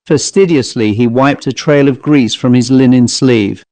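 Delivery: ordinary delivery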